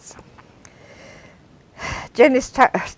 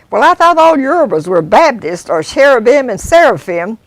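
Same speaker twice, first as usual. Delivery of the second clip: Obie teasing; 5 semitones higher, 6 dB louder than usual. Acted. Obie chuckling remark.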